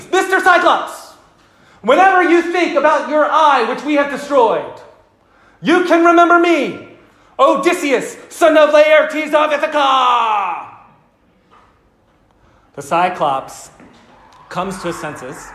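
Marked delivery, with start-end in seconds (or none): 0.1-10.8 gloating shout. Retold or imitated. Odysseus taunting the blinded Cyclops from the boat, a proud gloating shout. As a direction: crow your full name like a boastful hero. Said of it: "He says".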